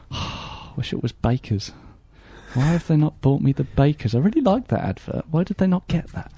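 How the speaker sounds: soft, sighing dog's inner voice, rising plaintively; wistful dog. Acted. Dog looking at its dinner, softly plaintive. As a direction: let down and pining, quietly. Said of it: "He's looking at it and going".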